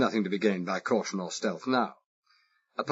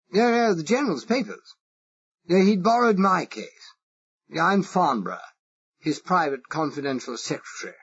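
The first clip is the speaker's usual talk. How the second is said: exaggerated posh English accent; 9 semitones higher, 4 dB louder than usual. Spy asking the Irish foreman, exaggerated posh English accent. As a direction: play the harmless toff with a marked English accent. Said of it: "I exaggerated it".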